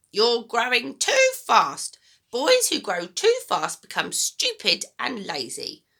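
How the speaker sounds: put-on high, loud, bossy old woman; a mean grandma giving orders, high and loud; grumpy grandma voice. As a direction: nag the boy like a sour old granny. Grandma scolds.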